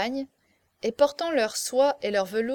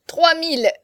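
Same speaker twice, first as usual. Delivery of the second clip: high, loud, strained shout; strangled cry; 6 semitones higher, 8 dB louder than usual. A narrator's reading. Fat wool merchant bidding desperately, strangled cry. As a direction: shout the bid as if choking. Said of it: "cria d'une voix étranglée".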